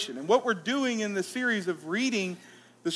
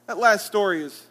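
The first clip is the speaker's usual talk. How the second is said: ordinary talk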